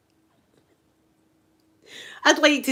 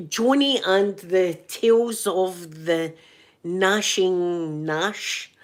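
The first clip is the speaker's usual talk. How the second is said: very slow, drawn-out film title; grand title announcement; 2 semitones lower, much slower than usual. Pretend. Offering a better title for the film, grandly drawn out. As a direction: stretch each word like a mock film title.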